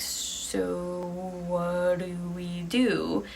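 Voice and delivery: very slow, stretched-out uncertain question; hesitant, drawn out. Acted. Uneasy couple ask each other what to do, hesitantly drawn out. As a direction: drag out every word in doubt.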